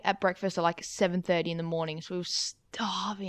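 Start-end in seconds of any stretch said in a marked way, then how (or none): 2.0-3.3 whispered. Real, not acted. Whispered complaint.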